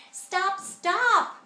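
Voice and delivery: loud, high scream of stop. A narrator's reading. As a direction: scream to make him hang up. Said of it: "screamed my little sister".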